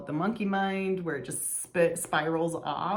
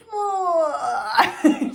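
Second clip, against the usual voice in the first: sudden high, loud burst of laughter; exasperated laugh; 12 semitones higher, 10 dB louder than usual. Real, not acted. Mom laughing at her own frustration with her daughter, high loud laugh. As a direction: laugh it out, half at herself.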